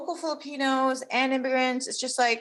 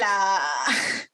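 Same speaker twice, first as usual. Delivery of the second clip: laughing mock line; 3 dB louder, slower than usual.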